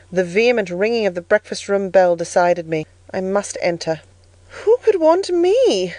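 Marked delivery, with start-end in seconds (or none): 4.5-5.9 high, slow, wondering question; anxious inward wondering. A narrator's reading. Child outside the breakfast room door, wondering inwardly. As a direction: puzzle it out to yourself, pitched up. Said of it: "I asked inwardly".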